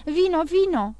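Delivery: high, loud repeated summons; urgent call. A narrator's reading. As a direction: call out for help at once. Urgent calling for help. (chemare insistentă, cu glas ridicat)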